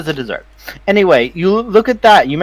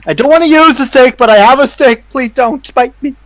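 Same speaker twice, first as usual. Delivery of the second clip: higher, louder panicky warning; nervous bluster; 8 semitones higher, 4 dB louder than usual. Acted. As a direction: wave a stick at a dog you dread. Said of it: "They're like".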